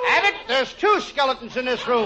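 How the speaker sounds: high-pitched frightened alarm; hammed-up fright; comic panic. Frightened comic sees skeletons.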